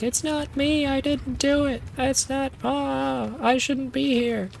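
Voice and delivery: hammed-up high-pitched wail of denial; mock freaking out. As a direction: imitate a panicked prisoner pleading innocence. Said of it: "she's freaking out".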